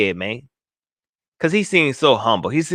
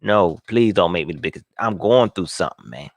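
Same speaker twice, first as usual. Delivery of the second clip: pleading player; 7 semitones lower than usual. Acted. Host voices player begging off the disappointment award, low mock plea. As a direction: lightly act out a downcast player begging. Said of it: "he just like".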